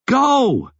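high, urgent yell. A narrator's reading. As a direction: yell a desperate order. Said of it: "she yelled".

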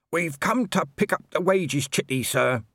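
put-on dutiful young constable. A narrator's reading. Constable Shu politely asking Colon for the wages chitty. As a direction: report earnestly to your captain.

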